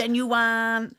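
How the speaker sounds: copying the reader's "Genuine"; mock audiobook inflection. Mimicking the audiobook line.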